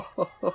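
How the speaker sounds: villain laugh. Acted.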